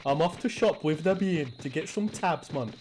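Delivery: imitating a Geordie dad's accent; light mock Geordie accent; Geordie impression. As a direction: put on your Newcastle dad's voice, casual and chatty. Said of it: "He's from Newcastle".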